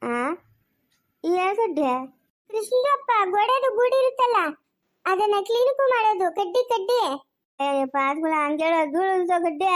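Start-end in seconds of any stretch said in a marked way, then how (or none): 2.5-7.0 high-pitched neighbour woman groping for words; chatty neighbour Padma. Acted. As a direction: call out and ask in a high voice, in broken Kannada. (ಎತ್ತರದ ದನಿಯ ಒಡಕು ಕನ್ನಡದ ನೆರೆಮನೆಯಾಕೆ)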